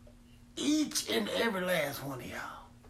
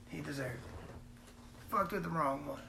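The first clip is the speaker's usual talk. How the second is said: muttered aside; 4 semitones lower, 10 dB quieter than usual. Acted. Dark punchline aside.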